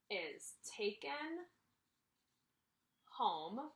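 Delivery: each word drawn out, slow; slow dictation. Shown as a demonstration. Teacher dictating slowly while writing on the Venn diagram. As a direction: spell it out slowly as you write. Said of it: "slow dictation while writing".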